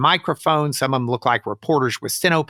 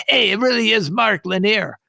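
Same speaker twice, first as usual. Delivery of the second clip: retold yell; 8 semitones higher than usual. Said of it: "yelled at his friend".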